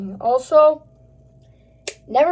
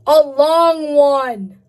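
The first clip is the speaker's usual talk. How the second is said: drawn-out promise; 5 dB louder, much slower than usual. Real, not acted. Promising long video.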